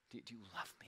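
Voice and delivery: breathy pleading whisper to God; whispered prayer. Retold or imitated. Pastor retelling his plea to God, whispered. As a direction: ask it in a bare whisper, desperate.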